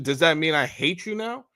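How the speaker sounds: ordinary talk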